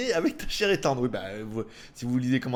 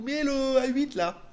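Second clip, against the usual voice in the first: high, drawn-out imitation of nagging; mock insistent demand; 9 semitones higher, slower than usual. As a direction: whine for it like an impatient client. Imitates shop demanding the next review.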